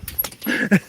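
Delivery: high nervous laugh; startled laugh. Real, not acted. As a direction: burst into a shocked laugh. Startled by lightning.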